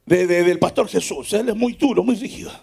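low, softening mimicry of a complainer; mock grumbling. Churchgoer objecting to a strict preacher, mimicked low grumble.

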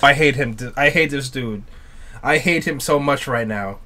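very soft, low grumble; low resentment. Real, not acted. Viewer hating the villain, quiet resentment. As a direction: say it under your breath, sunk and low.

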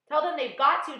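high, loud, urgent answer; insistent reply. A narrator's reading. James answering the centipede below, insisting loudly. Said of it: "James answered".

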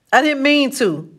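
high, upset blurt. A narrator's reading. Crying boy defends himself to his friend, blurting it out. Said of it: "he finally blurted out".

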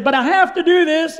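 high, loud, crying-voice protest; mock tearful father. Father reluctant to spank his crying daughter, hammed-up tearful voice. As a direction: plead as if starting to cry. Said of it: "then I'm starting to cry".

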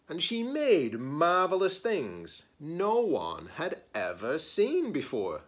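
lively picture-book read-aloud; story narration. Acted. Librarian reading a picture book to her students, expressive storytelling. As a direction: read it like story time for kids.

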